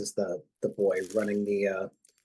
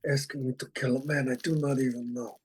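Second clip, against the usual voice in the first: dad's bad Godfather impression; 4 semitones higher than usual. Player does dad's bad Godfather line, lightly voiced. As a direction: do a deliberately bad Don Corleone. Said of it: "That's my dad's really bad impression".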